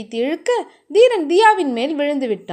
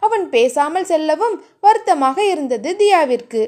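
usual speaking voice

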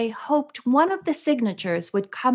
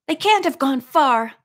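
urgent; 5 semitones higher, 5 dB louder than usual. A narrator's reading. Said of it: "the Lavender sister replied".

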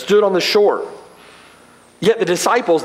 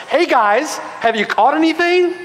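a teasing, higher sarcastic ask; sarcastic question; 8 semitones higher than usual. Retold or imitated. Jesus teasing the empty-netted disciples, sarcastic. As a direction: needle them with a mock-innocent question. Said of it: "a tone maybe of sarcasm in the question".

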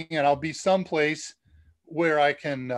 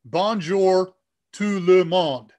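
French read in a heavy English accent, slow and loud; 5 dB louder, much slower than usual. Shown as a demonstration. Host mimics the speech program reading French, slow English accent. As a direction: say it word by word with English vowels, English accent. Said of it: "it'll say in English accent".

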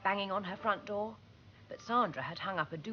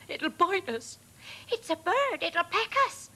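moaned fear; 9 semitones higher, 5 dB louder than usual. A narrator's reading. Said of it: "moaned the second".

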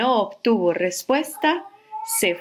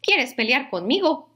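higher, grumpy little bug voice; grouchy ladybug; 7 semitones higher than usual. Acted. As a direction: taunt it like a cranky ladybug. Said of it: "le dijo la mariquita malhumorada".

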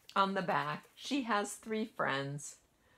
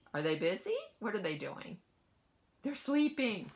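usual speaking voice

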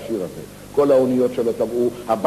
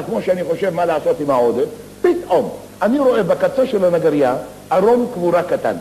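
normal talking voice

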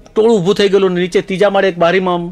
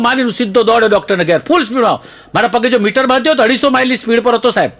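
usual speaking voice

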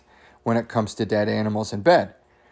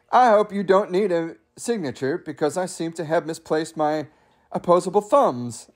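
higher-pitched mock British accent; shaky-accurate snooty British; 5 semitones higher than usual. Raccoon excusing himself to the mailman, bad British accent. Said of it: "I don't know if that's British".

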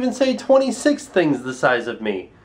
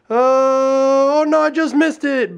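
mock surprise; 7 semitones higher, 4 dB louder, slower than usual. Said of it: "I'd be like".

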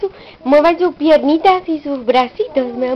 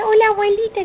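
put-on baby's line; 6 semitones higher, faster than usual.

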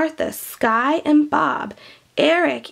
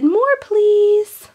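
put-on high, loud little child voice; children pleading for more stew; kids begging; 9 semitones higher, 5 dB louder than usual. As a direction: beg like a hungry child. Children asking in story.

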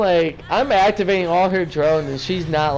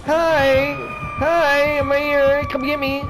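high taunting call; 6 semitones higher, slower than usual. Acted. Taunting the killer, calling out.

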